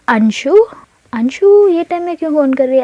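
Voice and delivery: startled why is she calling now; girl surprised by her best friend's call. Acted. Sees best friend calling oddly.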